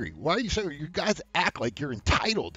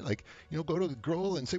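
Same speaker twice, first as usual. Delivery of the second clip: ordinary talk, nothing marked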